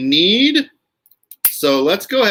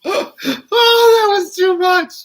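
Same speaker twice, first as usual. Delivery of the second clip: high, slow laugh-filled words; wheezing through laughter; 4 dB louder, much slower than usual. Real, not acted. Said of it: "laughing at own reading".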